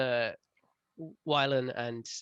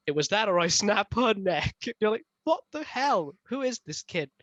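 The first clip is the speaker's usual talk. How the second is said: high, loud, disbelieving reader voice; incredulous outrage; 8 semitones higher, 6 dB louder than usual. Retold or imitated. Reader mimicking her own shock at Kaz, high and loud. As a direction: squeal it like you can't believe the book.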